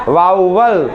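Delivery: "वाउवल" said as "vauvel", low and clear; teacher repeats "वाउवल" for students; correct vowel. Shown as a demonstration. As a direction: model "वाउवल" with an open "vau". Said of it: "correct pronunciation होता है वाउवल".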